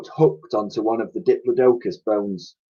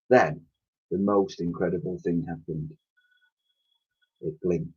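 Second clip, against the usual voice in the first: softer, lower reveal; hushed suspense; 5 semitones lower, 8 dB quieter than usual. A narrator's reading. Narrator hushes the mammoth's blink. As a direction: let the words drop in awe.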